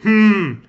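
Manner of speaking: loud, high menacing hmm; ominous hum. Acted. Game narrator sealing the player's fate, loud menacing hum. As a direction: hum it like a sinister threat.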